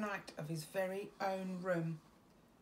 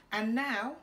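louder excited call; cry out; 3 semitones higher, 7 dB louder than usual. A narrator's reading. Max crying out to the wild things. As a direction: announce it like a king. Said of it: "cried Max".

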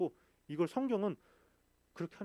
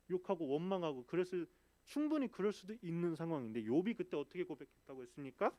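ordinary talk

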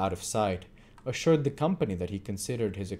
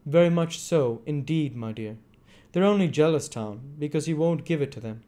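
put-on sly old man, high; 7 semitones higher, 5 dB louder than usual. A narrator's reading. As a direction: coax and flatter slyly.

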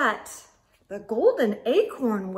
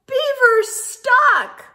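much higher, loud, slow cry; alarmed call; 11 semitones higher, 7 dB louder, slower than usual. Acted. Friends telling Squirrel that Beaver is stuck, loud alarm. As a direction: shout the news across the trees.

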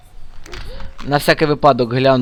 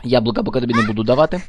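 ordinary delivery

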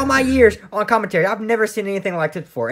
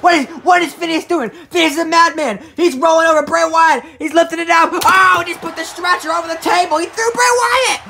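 raised, urgent play-by-play; excited wrestling commentary; 8 semitones higher, 4 dB louder than usual. Acted. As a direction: call the sudden turnaround as it happens. Calls the stretcher attack.

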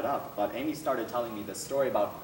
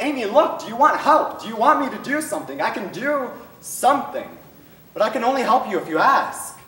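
higher and louder, pressing for an answer; urgent plea; 9 semitones higher, 7 dB louder than usual. Retold or imitated. The counsellor urging Amy to ask for help, loud and pressing. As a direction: push hard to make her ask for help.

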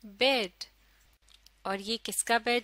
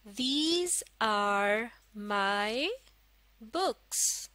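very slow, a pause after each word; word-by-word model reading; much slower than usual. Shown as a demonstration. Teacher models a sentence for learners, word by word. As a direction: space out every word for learners.

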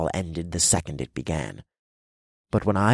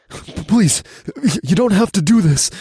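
spluttering plea; 12 semitones higher, 7 dB louder than usual. Acted. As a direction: splutter it out in terror. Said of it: "he spluttered".

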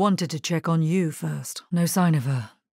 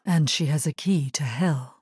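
ordinary talk

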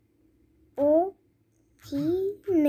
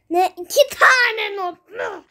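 outraged shout; 4 semitones higher, 4 dB louder than usual. Real, not acted. Outraged at the game.

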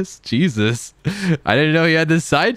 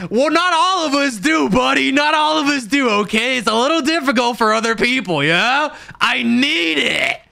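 high, mocking "buddy" retort; sarcastic; 9 semitones higher than usual. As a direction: sneer it back at him, sing-song. Sarcastic retort to Pint's boast.

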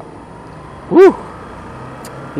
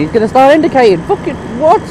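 incredulous rant; 9 semitones higher, 4 dB louder, faster than usual. Real, not acted. Rider ranting about a late indicator, incredulous. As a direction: voice climbing high in disbelief.

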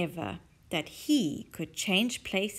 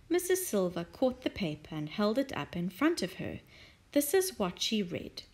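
ordinary delivery

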